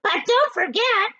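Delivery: high, loud, sneering aunt; high mocking hag voice; Aunt Spiker's voice. A narrator's reading. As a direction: jab at her sister in a high voice. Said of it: "Aunt Spiker cried".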